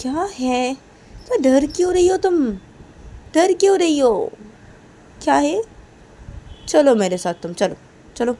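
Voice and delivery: high, loud why-are-you-scared; scolding. Acted. Kajal chiding scared Reshma, high and loud. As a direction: chide her fear and pull her along.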